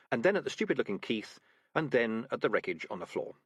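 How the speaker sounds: ordinary delivery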